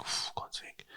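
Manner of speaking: breathless muttered shock; whispered exasperation. Retold or imitated. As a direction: whisper it under your breath. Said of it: "And I was like".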